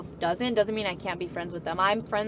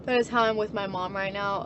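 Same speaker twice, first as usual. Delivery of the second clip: usual speaking voice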